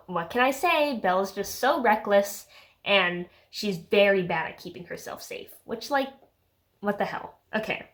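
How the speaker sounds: ordinary talk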